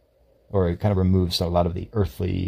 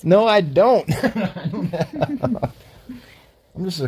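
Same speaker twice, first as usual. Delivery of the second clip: high, laughing no; laughing denial; 12 semitones higher than usual. Real, not acted. Brushing off his co-host's praise, laughing. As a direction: laugh it off, voice going up. Modestly denying having all wisdom.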